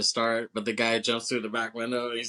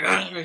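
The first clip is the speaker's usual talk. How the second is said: higher mock attacking "argh"; comic savage outburst; imitated biting growl; 7 semitones higher, 4 dB louder than usual. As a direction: act out chomping on a hand. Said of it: "starts biting the guy's hand".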